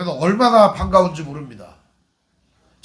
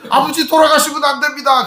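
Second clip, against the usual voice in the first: pleading son; 7 semitones higher than usual. Pretend. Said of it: "요셉이 여기에 대꾸를 해야 되잖아요".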